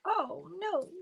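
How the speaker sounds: high, slow little oh no; soft drawn-out dismay; mock dismay. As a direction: wail it small and high. Voicing dismay at torn piece.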